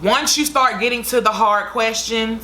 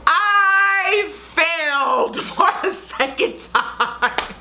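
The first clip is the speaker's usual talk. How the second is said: high, slow, laughing wail of failure; laughing cry of defeat; 9 semitones higher, much slower than usual. Real, not acted. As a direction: tell the viewers you failed, laughing in disbelief. Announcing failed exam result.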